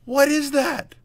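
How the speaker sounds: very high, bewildered question; real high-voiced exasperation; incredulous squeal. Real, not acted. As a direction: pitch it way up in disbelief. Stunned after losing shot.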